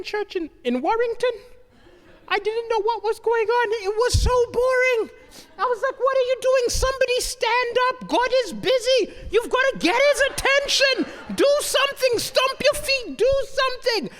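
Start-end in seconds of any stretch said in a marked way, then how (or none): 6.0-14.1 high-pitched exasperated plea; exasperated urging. Retold or imitated. Bored churchgoer urging the congregation to act, exasperated and high. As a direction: urge them on, high and agitated. Acted outburst in boring church.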